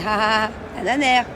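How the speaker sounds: ordinary talk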